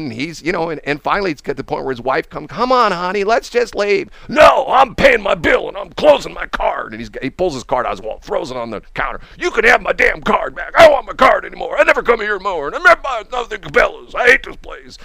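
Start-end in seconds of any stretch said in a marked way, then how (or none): 2.5-4.1 higher-pitched mock plea; imitated pleading wife. Wife to her yelling husband, imitated urgent pleading. 4.3-6.9 loud, high mock shouting; retold yelling. Husband refusing his wife at the counter, imitated angry shouting. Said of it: "this guy's yelling and screaming". 9.4-15.0 loud, high-pitched mock tirade; retold screaming. Customer throwing his card on the counter, imitated screaming rant. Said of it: "this guy's yelling and screaming".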